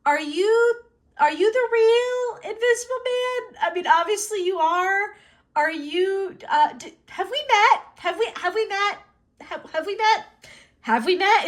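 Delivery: high, halting, embarrassed questions; awkward nervous asking. Acted. Embarrassed fangirl asking Invisible Man if they met, high and nervous. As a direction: fumble upward through an awkward introduction.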